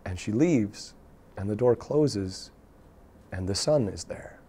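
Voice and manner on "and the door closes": usual speaking voice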